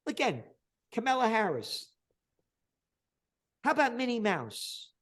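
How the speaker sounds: high, loud contemptuous yelling; furious mocking outburst; angry rant. Real, not acted. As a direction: spit out the scorn. Ranting about politicians, rising contempt.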